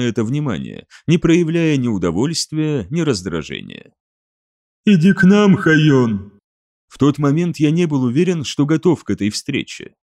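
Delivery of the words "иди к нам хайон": eldritch horror. Acted. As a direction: call him as a hive voice. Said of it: "нечеловеческий голос".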